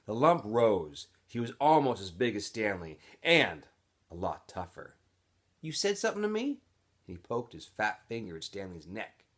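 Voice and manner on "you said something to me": put-on much higher, menacing tough-kid voice; big camp bully squaring up to the new kid; bully's challenge. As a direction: push him, daring him to answer. Bully confronting Stanley.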